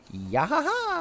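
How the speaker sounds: high, rising "ya-ha-ha" call; mock cheery "ya-ha-ha". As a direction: chirp out a high, put-on laugh. Imitates a game sound.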